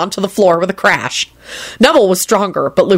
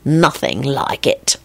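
low, slow relish; deep, unhurried ominous pleasure; ominously satisfied; 4 semitones lower, 4 dB quieter, much slower than usual. A narrator's reading. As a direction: linger darkly on every word. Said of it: "in an ominously satisfied voice".

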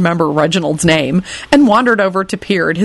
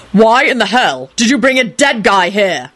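raised pitch, restrained volume; wrathful shout; 4 semitones higher than usual. A narrator's reading. Quentin confronts Lupin over the corpse, wrathfully. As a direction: demand it wrathfully. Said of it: "Quentin shouted wrathfully".

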